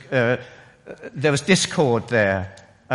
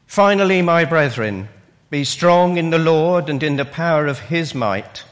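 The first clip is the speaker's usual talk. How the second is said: louder, higher solemn reading; scripture reading; 3 semitones higher, 5 dB louder than usual. Acted. Preacher reading Ephesians aloud to the church, solemn and loud. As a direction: proclaim the text to the hall.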